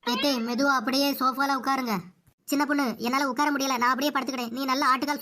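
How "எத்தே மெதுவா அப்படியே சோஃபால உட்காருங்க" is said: normal talking voice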